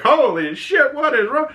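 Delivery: high shocked yelp, imitating Herb; squeaky startled neighbor. Herb startled by his naked neighbor, high mock yelp. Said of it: "Herb's like".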